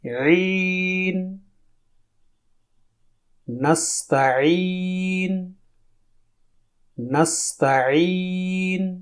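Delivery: long slow drill of نستعین; soft slow drawn ain. Shown as a demonstration. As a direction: draw out نستعین gently. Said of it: "اسے سنیے".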